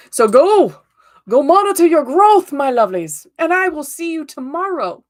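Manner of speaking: loud, high cheering farewell; rousing send-off. Real, not acted. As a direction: pump the viewers up. Energetic sign-off to viewers.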